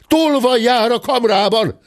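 high, urgent call; cried alarm. A narrator's reading. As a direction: cry out a warning to the house. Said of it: "hallatszott ebben a percben egy kiáltás".

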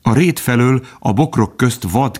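ordinary delivery

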